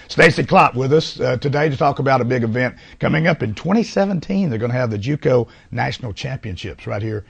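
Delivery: bright announcer sign-off; TV host announcer. Acted. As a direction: wrap up like a TV host.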